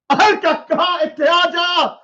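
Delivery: high calling shout. Pretend. Calling a boy named Al Kaka to come here, high shout. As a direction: summon him from a distance.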